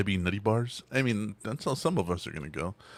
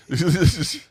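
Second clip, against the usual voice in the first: loud laugh; 9 semitones higher, 8 dB louder than usual. Real, not acted. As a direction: burst of high loud laughter. Reacting to roast story.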